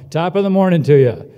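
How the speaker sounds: a cheery shout-out waiting for the reply; loud greeting call again. Pretend. Host calls to students for their response, loud cheery greeting. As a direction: belt it out loud to the room so they answer.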